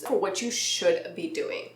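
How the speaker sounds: ordinary talk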